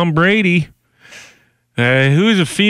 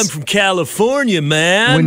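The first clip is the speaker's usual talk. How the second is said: higher mock California surfer voice; California surfer accent; 4 semitones higher than usual. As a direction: play a nineties California dude. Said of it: "the California accent used to be, like".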